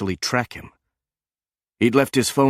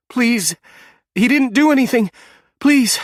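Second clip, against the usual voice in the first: much higher and louder, desperate crying plea; sobbing plea; 6 dB louder than usual. A narrator's reading. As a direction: beg through tears for her husband. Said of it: "A sob came over the monitor".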